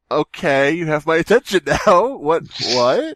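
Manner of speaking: suddenly all ears, hammed up; mock intrigue. Host retelling seeing his ex online, eager mock surprise. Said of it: "So I'm like".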